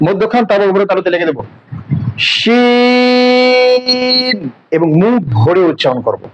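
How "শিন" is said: full-mouthed shin with a very long held vowel; shin articulation demo. Shown as a demonstration. Teacher showing students shin with a full mouth, elongated demo. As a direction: stretch shin out on one high, level note. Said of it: "এখন এই হরফটি উচ্চারণ করব".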